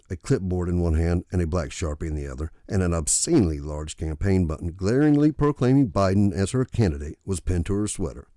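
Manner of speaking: usual speaking voice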